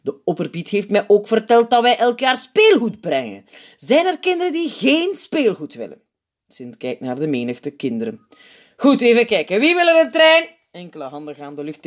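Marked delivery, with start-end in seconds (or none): 0.0-6.0 put-on higher, louder kindly old man; Sint addressing kids. A narrator's reading. 8.8-10.5 high, loud booming question; Sint addressing kids. A narrator's reading. Sint asking the crowd.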